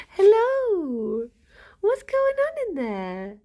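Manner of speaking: high sing-song pet voice; talking to a pet. Real, not acted.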